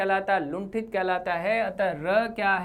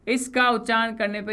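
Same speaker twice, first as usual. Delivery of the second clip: usual speaking voice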